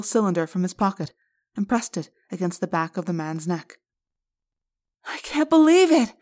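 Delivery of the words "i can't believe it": very high and loud joy; girl overjoyed the truth may get out; exultant. Acted. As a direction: burst with disbelieving delight. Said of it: "Cassie exulted".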